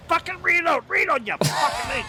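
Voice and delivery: yelling in frustration at the gun; angry shout; frustrated shouting. Real, not acted. Gun won't reload, yelling.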